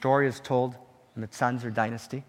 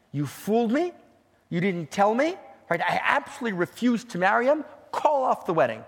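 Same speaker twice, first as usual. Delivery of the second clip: raised, higher-pitched angry bride refusing; louder and higher, she refuses outright; upset protest; 8 semitones higher, 6 dB louder than usual. Acted. Bride protests to her father.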